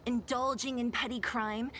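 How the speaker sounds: ordinary delivery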